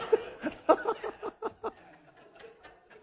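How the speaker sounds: high breathless burst of laughter; real laugh. Real, not acted. Speaker cracking up at the child's remark, high airy laugh. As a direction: laugh out loud at your own story. Punchline of her own story.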